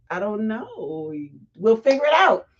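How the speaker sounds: ordinary delivery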